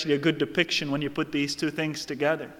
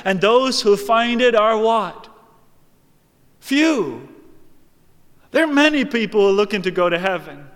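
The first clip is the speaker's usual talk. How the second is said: forceful preaching, building higher and louder; 5 semitones higher, 6 dB louder than usual. Real, not acted. Preacher warning churchgoers that few find the way, forceful preaching. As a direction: preach it hard, pressing the warning home.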